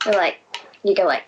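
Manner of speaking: ordinary talk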